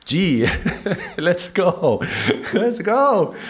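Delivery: excited teacher rallying the class. Real, not acted. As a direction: hype up the start. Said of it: "I'm getting excited".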